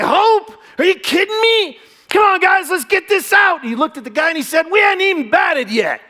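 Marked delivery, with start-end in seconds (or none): 0.0-3.6 put-on very high, loud boy scoffing then rallying; incredulous cheering kid. Kid laughing off stranger's doubt, loud eager boy voice. 4.7-6.0 put-on high, loud, bright-eyed boy answering; eager kid. Kid telling stranger the score, loud cheerful boy voice.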